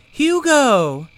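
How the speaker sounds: high, loud shouted name; startled cry. Acted. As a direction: cry out his name in shock. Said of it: "With a cry".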